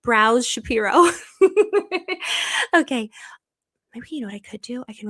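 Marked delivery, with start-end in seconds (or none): 0.0-1.1 slow, louder, laughing through the name; giggling mock mobster name. Real, not acted. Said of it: "that's his mafia name". 1.1-2.7 high rising laugh; real giddy laughter; delighted laugh. Real, not acted. Laughs at mobster name.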